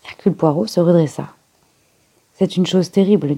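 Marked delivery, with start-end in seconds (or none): none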